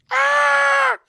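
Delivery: high, loud demonic shriek; demonic scream. Acted. Possessed girl in the bedroom lets out a loud demonic shriek. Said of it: "loud demonic screaming".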